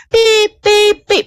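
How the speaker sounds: high, piercing electronic beeps; imitated alarm beeps. Co-host's alarm going off, high imitated beeping. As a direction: beep like an alarm clock. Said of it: "I'm hearing his alarm".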